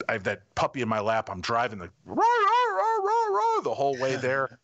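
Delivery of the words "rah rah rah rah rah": high siren wail, mouthed like an ambulance; a high wailing siren sound, louder; siren imitation. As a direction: play the siren yourself, rising and falling. Imitating siren driving to vet.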